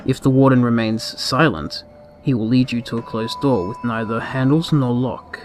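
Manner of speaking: ordinary delivery, nothing marked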